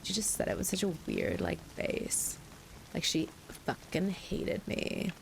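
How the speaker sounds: slow Californian drawl with vocal fry; creaky, stretched-out Californian accent; Casey. Retold or imitated. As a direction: draw it out like a vocal-fry Cali girl. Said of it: "she talked like this".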